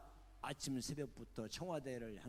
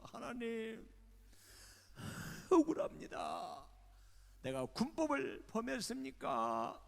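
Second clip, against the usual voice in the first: wailing prayer; 9 semitones higher, 7 dB louder than usual. Retold or imitated. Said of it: "하고 엉엉 울면서".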